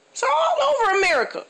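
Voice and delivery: high, indignant insistence; annoyed comic insisting, voice high; exasperated outburst. Real, not acted. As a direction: make them see it's everywhere.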